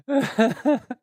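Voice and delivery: sudden high embarrassed laugh; high burst of laughter. Real, not acted. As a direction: laugh out loud at yourself, high-pitched. Embarrassed laugh at own story.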